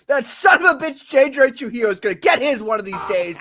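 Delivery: loud, high-pitched ranting burst; angry outburst. Pretend. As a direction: blow up in a mock rage. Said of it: "I'm much calmer now".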